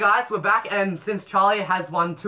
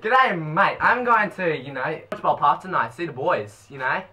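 broad blokey Aussie bogan; hammed-up Australian accent; put-on mate-to-mate bogan. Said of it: "One hundred percent Bogan".

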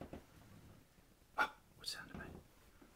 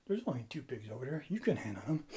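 fast, softer nudge; quiet push; 5 semitones lower, 10 dB quieter, much faster than usual. Acted. Friend quietly urging the scared bird forward. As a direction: hurry them along softly.

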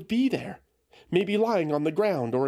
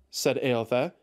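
plain reading voice

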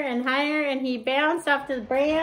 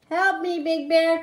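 high, loud cry for help; 5 semitones higher, 6 dB louder, slower than usual. Acted. Little Bear fallen in the water calls Big Bear, loud high cry. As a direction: call out for rescue, raised and high. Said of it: "cried Little Bear".

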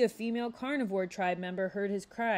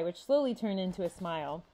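plain reading voice

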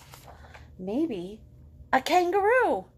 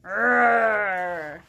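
loud playful growl; tiger growl; 2 semitones lower, 6 dB louder than usual. Shown as a demonstration. Teacher growling for the kids, playful and loud. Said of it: "Can you growl?".

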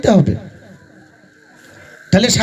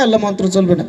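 ordinary talk, nothing marked

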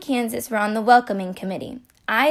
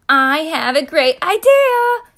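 higher, louder excited voice; exclaiming; 5 semitones higher, 6 dB louder than usual. Acted. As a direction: burst out with the idea. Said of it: "exclaimed Kansas".

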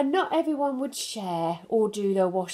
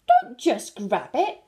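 slow, louder mock-angry rabbit; a mildly cross rabbit scolding; 6 semitones higher, 8 dB louder than usual. A narrator's reading. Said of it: "says angry rabbit".

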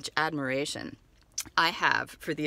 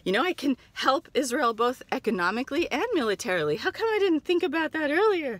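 gushing customer; 7 semitones higher, 4 dB louder than usual. Acted. Naive customer answering seller.

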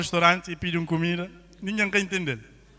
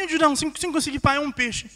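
pleading; 7 semitones higher than usual. Acted. Fisherman begging God for a fish.

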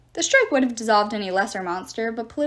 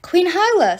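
loud, high gasped name; a loud startled cry; surprised recognition; 8 semitones higher, 6 dB louder than usual. A narrator's reading. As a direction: spot her and call out amazed. Hazel sees the queen.

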